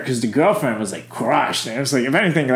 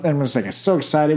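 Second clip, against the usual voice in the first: ordinary talk, nothing marked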